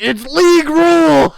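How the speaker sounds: high, loud furious yell; screaming about bowling rules at a guy he pulls a gun on; angry shout. Retold or imitated. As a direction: bellow it like a man losing it over bowling rules. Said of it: "He's like".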